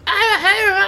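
very high, odd squeaky voice; Mister Bean voice. Retold or imitated. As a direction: squeak it out like a silly comic character. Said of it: "Parce qu'il a une voix comme ça".

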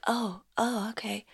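fading, awed whisper; hushed awe. Retold or imitated. Retelling first look at herself, hushed awe. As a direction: trail off in quiet wonder.

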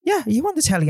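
high mock fan voice; woman asking. Woman in theatre aisle asking him, high mock fan. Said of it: "And she goes".

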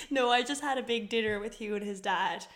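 ordinary delivery

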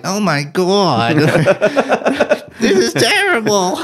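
high, strained, whiny nerd. Retold or imitated. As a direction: squeal it like a panicking nerd. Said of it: "I like your, uh, nerd voice there".

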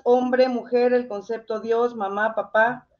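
normal talking voice

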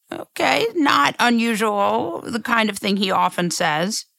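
normal talking voice

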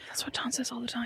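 whispered aside; conspiratorial whisper behind a hand. Real, not acted. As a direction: breathe it as a secret. Aside to co-host.

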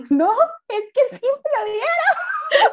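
half laughing, half crying confession; laughing through it. Retold or imitated. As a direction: laugh helplessly as you say it. Retold crying, now laughing.

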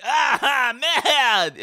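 high-pitched comic French outburst; mock angry French. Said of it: "when French people get angry".

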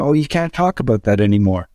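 mock the people leaving comments; mocking commenter. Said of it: "are coming out saying".

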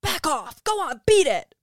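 loud, high shout; shouting at mugger. Retold or imitated. Doubtfire yelling at a mugger, loud and high. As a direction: yell at the mugger to go. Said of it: "Missus Doubtfire's purse".

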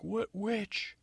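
high, slow pompous call from the walls; high slow theatrical yell; prince yelling down. Acted. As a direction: proclaim it like a hammy prince. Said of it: "he's yelling down".

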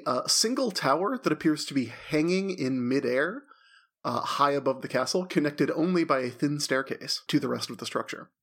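ordinary talk, nothing marked

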